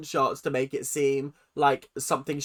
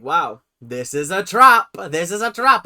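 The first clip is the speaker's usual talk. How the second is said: dramatic alarm; 3 semitones higher, 10 dB louder than usual. Acted.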